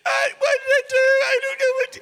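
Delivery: very high, loud mock wail of a bewildered child; hammed-up tearful kid protesting; crying little boy. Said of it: "And I was like".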